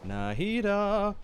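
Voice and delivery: drawn-out high call of the name; sing-song greeting. Real, not acted. Streamer greets Nahida, child number two, high drawn-out sing-song. Greets a favourite game character.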